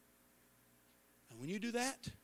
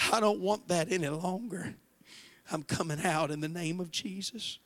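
fervent prayer; 6 dB louder than usual. Acted. Leads congregation in prayer.

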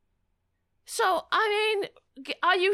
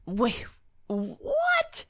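shocked disbelief; 12 semitones higher than usual. Real, not acted. Reacts in disbelief to clip.

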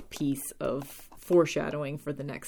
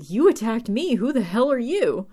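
higher, louder mock outrage; indignant comeback; 7 semitones higher, 7 dB louder than usual. Buffy confronting her attacker after a fight, indignant. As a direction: fire it back, affronted, in a swooping singsong.

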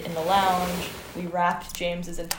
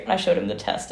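ordinary talk

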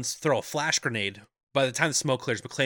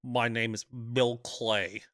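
1 mock Rickman accent; much slower than usual. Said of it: "So he sounds like Alan Rickman".

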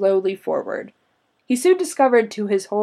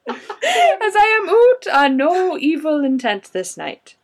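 a hammed-up Scottish accent, higher, laughing through it; 8 semitones higher, 5 dB louder, slower than usual. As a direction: laugh while forcing a Scottish brogue. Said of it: "say that again with a Scottish accent".

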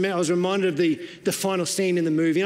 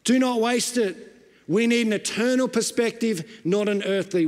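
raised pitch, driving sermon delivery; impassioned preaching; 7 semitones higher than usual. Real, not acted. Preacher warning a congregation not to waste their lives, impassioned. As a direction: urge the congregation with insistent, emphatic phrases.

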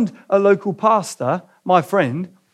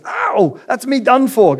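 much higher yelp then louder gasp; gasped cry; 7 semitones higher, 4 dB louder, slower than usual. Acted. Ghost as the angel kills the lizard, gasping cry. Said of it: "gasped the ghost".

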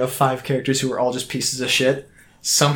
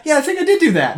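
smug mock admission; 14 semitones higher, 4 dB louder, faster than usual. Mac admits it, mocking.

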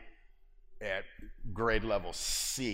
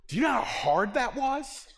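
much higher, louder laughing disbelief; exasperated laugh; 12 semitones higher, 6 dB louder than usual. Real, not acted. Preacher telling the congregation about the game, high laughing exasperation. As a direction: laugh it out, still amazed.

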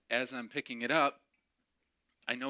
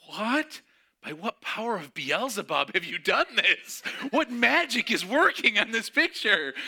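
much higher and louder, laughing, hammed-up awe; mock astonishment; 10 semitones higher, 8 dB louder than usual. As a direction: ham up disbelief at a magic trick.

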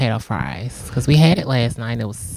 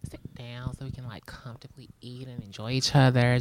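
whispering; 6 dB quieter than usual. Retold or imitated. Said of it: "I'm like".

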